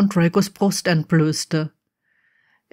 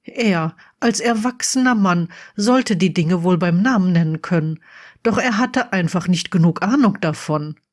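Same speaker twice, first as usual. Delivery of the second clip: ordinary delivery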